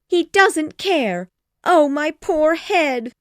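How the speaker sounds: high, loud, slow moaning complaint; milk the self-pity; whining mother. Acted. Mrs Bennet complaining.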